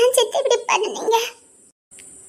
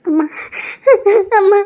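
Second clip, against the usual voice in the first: crying; 3 semitones higher, 4 dB louder than usual. Acted. Daughter crying to mother.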